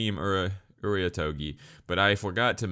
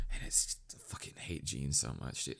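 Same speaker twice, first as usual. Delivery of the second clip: hushed, exhausted exasperation; muttered loathing; 3 semitones lower, 11 dB quieter than usual. Real, not acted. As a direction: drop to a soft, fed-up mutter. Deflated loathing after the loud imitation.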